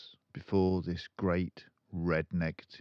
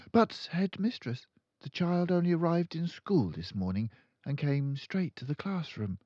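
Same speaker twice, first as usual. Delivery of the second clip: put-on high, polite young teacher; high pleading teacher; timid teacher; 12 semitones higher, 4 dB louder, faster than usual. A narrator's reading. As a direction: stand firm though nervous. Miss Honey objects.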